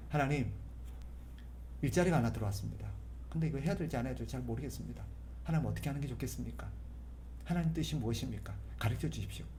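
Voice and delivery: soft, quiet talk to God; quiet sincere prayer to God; calm conversational prayer. Shown as a demonstration. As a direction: pray calmly as if chatting. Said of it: "차분하게 앉아서 이야기하듯이".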